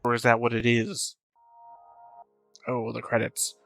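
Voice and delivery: usual speaking voice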